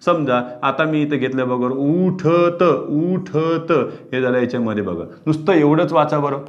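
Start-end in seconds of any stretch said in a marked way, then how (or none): 1.7-3.7 उठत drawn out very slowly with the ठ long and the त clipped; very slow demo. Shown as a demonstration. Teacher to class, sounding out उठत very slowly with a long ठ. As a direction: stretch ठ in उठत, clip the end. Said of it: "उठत म्हटलं तर हे ठ लांबतंय".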